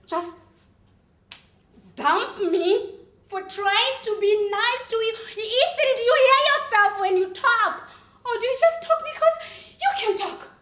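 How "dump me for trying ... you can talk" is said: raised, higher-pitched indignant rant; angry outburst. Acted. Confronting a boyfriend who dumped her, angry. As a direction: lash out, loud and high. Acted quarrel with Ethan.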